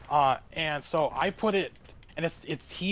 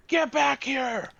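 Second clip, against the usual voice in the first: yelling after; 9 semitones higher than usual. Retold or imitated. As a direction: bellow after someone walking away. Customer yelling after him.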